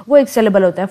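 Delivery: ordinary delivery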